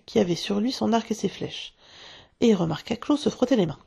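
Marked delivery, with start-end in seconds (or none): none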